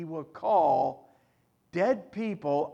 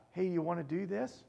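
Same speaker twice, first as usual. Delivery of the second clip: mock casual ask; 8 dB quieter, faster than usual. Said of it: "It's not a".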